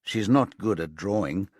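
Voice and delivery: ordinary delivery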